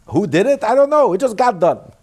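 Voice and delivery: high, loud protest of not knowing; emphatic feigned ignorance. Acted. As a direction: throw it up high and loud, disclaiming all credit. Acting out nobody knowing who.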